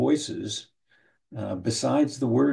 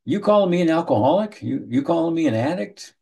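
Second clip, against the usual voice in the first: affronted, stammering challenge, a touch louder; indignant client; 5 semitones higher, 4 dB louder than usual. Retold or imitated. Client taking offense at being called an alcoholic, indignant. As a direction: bristle at the label. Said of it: "Common one in my line of work is".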